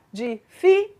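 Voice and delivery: DIFÍCEIS split with pauses, di... FÍ... ceis; syllable by syllable. Shown as a demonstration. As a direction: break DIFÍCEIS apart, stressing the middle FÍ. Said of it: "mas é paroxítona".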